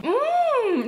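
high, loud pleased hum; delighted mmm. Real, not acted. Tasting the cookie dough, humming delight. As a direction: savour it out loud.

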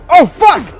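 loud, high shriek of fright; startled scream. Real, not acted. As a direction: scream out at a sudden scare. Sudden scare in game.

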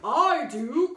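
loud bold duke; a stagey noble shout; duke's cry. A narrator's reading. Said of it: "cried the duke".

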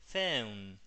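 "phone" slowly, with a stretched eu glide; eu diphthong. Shown as a demonstration. Teacher models the word, "phone" with drawn-out eu. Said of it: "es eu. Entonces es".

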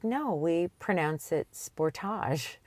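Sportage ending in a soft zh, spor-TAHZH; French Sportage. Retold or imitated. Host retells telling a Kia executive the French Sportage. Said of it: "And I said".

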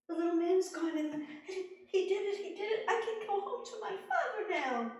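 high, loud giggly joy; overjoyed young woman. Acted. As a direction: laugh with relief. Rose rejoices.

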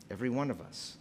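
ordinary talk, nothing marked

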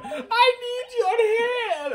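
high, drawn-out crying call; wailing Mario. Retold or imitated. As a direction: wail the name in hammed-up despair. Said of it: "I was Luigi at the time".

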